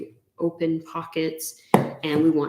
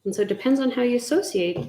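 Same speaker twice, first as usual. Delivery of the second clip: usual speaking voice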